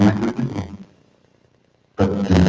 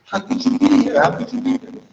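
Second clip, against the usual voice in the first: much higher, pleading mock astonishment; disbelieving old woman. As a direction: repeat it, unable to believe. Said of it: "kept looking at him like".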